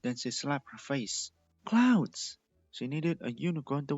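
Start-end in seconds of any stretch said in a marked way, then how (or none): 1.6-2.4 high, loud exclamation; sudden realization. Acted. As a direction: gasp it as it hits you.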